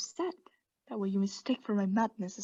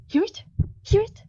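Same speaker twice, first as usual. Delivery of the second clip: feverish urging; 12 semitones higher, 7 dB louder than usual. Acted. Narrator urging listener.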